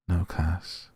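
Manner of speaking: plain reading voice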